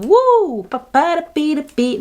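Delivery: high, whooping party yell; comic high samba whoop; samba cry. Pretend. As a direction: sing out like a bank account dancing the samba. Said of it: "danse la samba en se disant".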